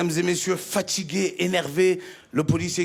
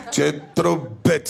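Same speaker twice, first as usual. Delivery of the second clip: low laughing dismissal; low amused laugh; laughing policeman; 6 semitones lower than usual. Retold or imitated. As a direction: chuckle it out as he shakes his head.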